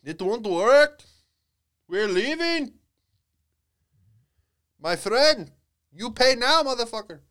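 hammed-up Indian accent, threatening; over-the-top Indian-accented threat; aggressive Indian accent. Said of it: "the accent comes off as aggressive".

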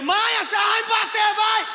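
put-on high shrieking child begging; hammed-up frightened child shout; panicked kid. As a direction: scream like a terrified boy about to be spanked. Child pleading before spanking.

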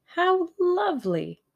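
high, drawn-out gush of delight. A narrator's reading. Tuppence thanking the man who hires them, delighted. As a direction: gush it loud and high, stretching the words.

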